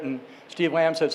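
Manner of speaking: usual speaking voice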